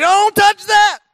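high, panicked shout; freaked-out dad. Acted. Dad stopping his son reaching for it, panicked yell. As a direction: yell it suddenly at full alarm. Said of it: "his dad kind of freaks out".

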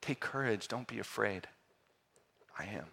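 preacher quietly comforting the congregation; gentle reassurance. Acted. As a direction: speak it close and comforting. Sermon repeats Jesus' words gently.

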